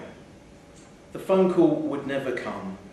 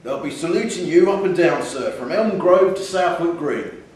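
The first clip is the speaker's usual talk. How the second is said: louder, booming shopkeeper sales pitch; hearty shopkeeper; 3 semitones higher, 6 dB louder than usual. Acted. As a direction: talk up the cap to a buyer, full of bluster. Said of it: "he'd been told by the".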